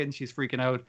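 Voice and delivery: ordinary delivery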